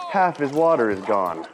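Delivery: ordinary delivery, nothing marked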